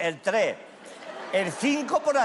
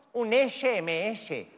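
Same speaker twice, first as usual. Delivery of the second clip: slow spelled-out letters; much slower than usual. Retold or imitated. Daughter spelling it out slowly.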